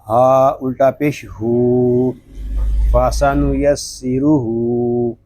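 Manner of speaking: slow, drawn-out letter-by-letter recitation; Quran spelling drill. Shown as a demonstration. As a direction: spell out each letter, stretching it slowly. (धीमे, खींचकर हिज्जे करते हुए)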